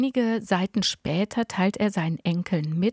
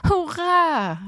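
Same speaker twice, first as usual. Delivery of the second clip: high, loud jubilant call; cheering from above; 9 semitones higher, 4 dB louder than usual. Acted. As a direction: call down joyfully from the ceiling. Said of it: "rief Opa von oben herunter".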